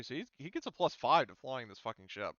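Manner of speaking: ordinary talk, nothing marked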